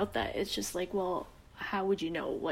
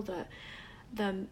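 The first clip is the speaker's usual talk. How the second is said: ordinary talk, nothing marked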